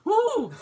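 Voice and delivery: high, loud mock-shocked hoot; startled "ooh". Daughter startled that dad yelled, amused "ooh". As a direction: hoot in shock, laughter breaking in.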